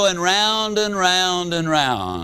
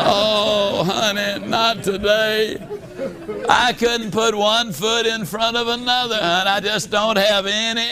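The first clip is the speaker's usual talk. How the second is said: husband pleading with his wife that he is too tired; whiny tired husband; 4 semitones higher than usual. Retold or imitated. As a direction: beg off like a worn-out husband.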